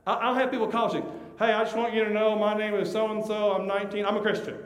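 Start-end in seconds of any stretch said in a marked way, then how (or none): 1.4-4.6 higher, louder put-on greeting; mock overeager self-introduction; eager introducer imitation. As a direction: announce yourself in one pitched-up run. Imitates people introducing themselves.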